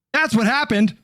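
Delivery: ordinary talk, nothing marked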